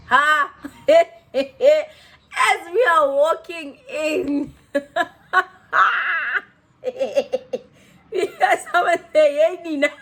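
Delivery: laughing hard through the words; can barely get it out for laughing. Real, not acted. Laughing hard while telling friends about the club. Cracking up retelling the club story.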